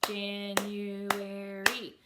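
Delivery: "January" very slow, Jan-u-ar-y; four stretched, even-pitched beats on "January"; syllable by syllable. Shown as a demonstration. Teacher clapping a four syllable word, "January" split Jan-u-ar-y. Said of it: "four syllable word".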